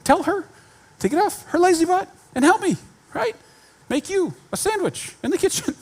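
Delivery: mock tattling on a lazy sister; whiny Martha. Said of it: "She's like".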